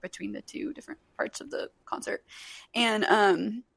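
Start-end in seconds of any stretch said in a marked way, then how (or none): none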